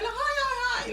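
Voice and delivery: very high, soft cheery hi; mock a chirpy office greeting; high-pitched work voice. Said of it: "you're high pitched anyway".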